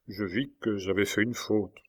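usual speaking voice

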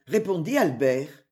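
plain reading voice

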